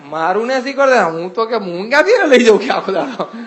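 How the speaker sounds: a retold father's huffy objection; indignant father. Father continues his complaint.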